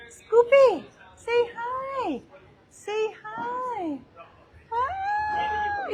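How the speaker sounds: very high squeaky dog-coaxing. Real, not acted. Owner prompting Scout to greet viewers, high pet talk.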